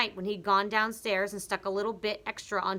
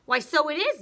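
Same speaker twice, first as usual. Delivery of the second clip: put-on higher, louder old wife; startled, peering at the stick; old wife noticing her long stick; surprised Mrs Twit; 8 semitones higher, 8 dB louder than usual.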